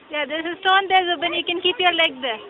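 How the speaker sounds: loud guiding call upward; calling up loudly. Real, not acted. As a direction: shout directions up the wall. Guiding a climber from below.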